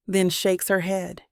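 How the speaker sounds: usual speaking voice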